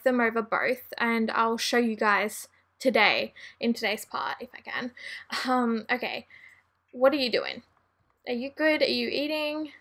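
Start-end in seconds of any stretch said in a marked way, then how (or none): none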